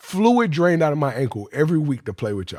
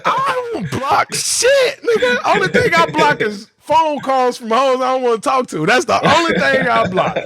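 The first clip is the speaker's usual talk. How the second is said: mock exasperated rant; 8 semitones higher than usual. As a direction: ham up the outrage at the game plan, pitch cracking way up. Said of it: "I was in the meeting like".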